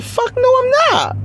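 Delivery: very high, mocking no; high-pitched scornful no; squeaky flat refusal. Refusing his kiss request.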